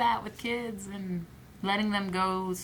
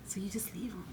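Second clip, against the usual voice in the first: whisper; 10 dB quieter than usual. Real, not acted. Quiet aside about abandoning child.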